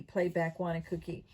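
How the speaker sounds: usual speaking voice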